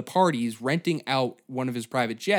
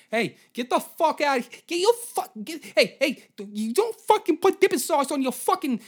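angry Boston pizza guy; 13 semitones higher than usual. Retold or imitated. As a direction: bellow at the customer like a hothead pizzeria owner. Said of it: "Just be like".

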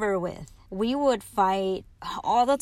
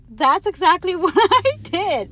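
high, loud, slow words through laughter; laughing with glee; 7 semitones higher, 7 dB louder, slower than usual. Real, not acted. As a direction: laugh your way through it, delighted. Laughing while recounting.

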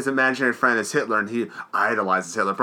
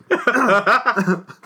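real burst of laughter; loud laugh; 8 semitones higher, 4 dB louder than usual. Real, not acted. Recalling the shepherd joke to friends, loud laughter.

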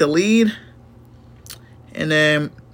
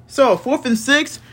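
ordinary delivery

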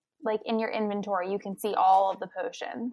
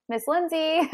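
put-on child calling; 6 semitones higher than usual. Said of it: "she'd be like".

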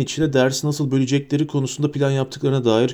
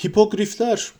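higher, a mild happy roar; cheerful roar; 9 semitones higher than usual. A narrator's reading. Hagrid proudly presents the hippogriffs, mild cheerful roar. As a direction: call them out with delight. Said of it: "diye kükredi Hagrid neşeyle".

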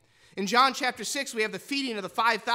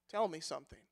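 quiet question; 8 semitones lower, 13 dB quieter, faster than usual. Acted. Nicodemus quietly asks Jesus.

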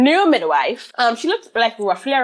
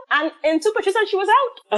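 normal talking voice

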